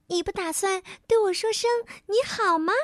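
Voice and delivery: high, quick sprite voice; cheeky elf. Acted. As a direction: tease him fast, like a mischievous little elf. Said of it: "小精灵问道". (又高又快的小精灵声音)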